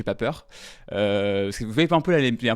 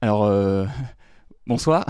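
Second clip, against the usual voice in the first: nervous stage greeting; 3 semitones lower, slower than usual. Retold or imitated. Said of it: "J'arrivais comme ça".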